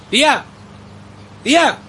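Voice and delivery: loud, high call across a crowd. Acted. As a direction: shout to get her attention. Calling aunt across the funeral.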